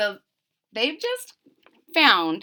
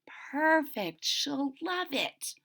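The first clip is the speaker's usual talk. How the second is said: soft reply; 4 semitones higher, 7 dB quieter, much slower than usual. Acted.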